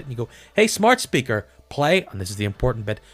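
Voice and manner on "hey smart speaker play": higher, louder, over-enunciated command to a gadget; funny smart-speaker voice. Pretend. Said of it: "that funny accent that you do when you talk to it".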